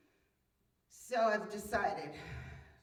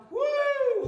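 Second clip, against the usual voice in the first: surprised woo; 10 dB louder than usual. Retold or imitated. Wobbling on stairs in the wind.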